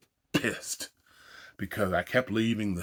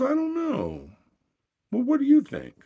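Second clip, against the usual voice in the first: much higher, put-on girlfriend voice; girlfriend's voice; 7 dB louder than usual. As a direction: play her coy and fishing for an answer, pitched way up. Girlfriend voiced trying on bracelet.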